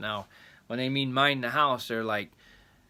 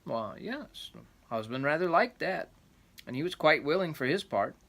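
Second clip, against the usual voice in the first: rambling grandpa story; 4 semitones higher, 3 dB louder than usual. A narrator's reading. Reading the folk tale aloud.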